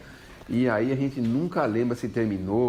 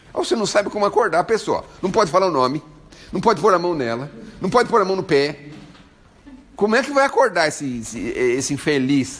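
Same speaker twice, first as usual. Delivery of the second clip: exasperated rant; 6 semitones higher, 5 dB louder than usual. Real, not acted. Exasperated rant about waking people.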